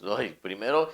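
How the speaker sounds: normal talking voice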